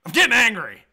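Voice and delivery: loud mock-angry outburst; mock anger. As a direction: pretend to lose your temper, loudly. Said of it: "Just kidding".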